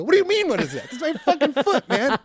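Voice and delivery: high-pitched mock disbelief; indignant retort. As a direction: protest incredulously, voice jumping up. Acted indignant reply to seatmate.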